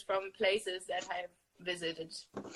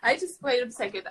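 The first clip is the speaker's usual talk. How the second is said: normal talking voice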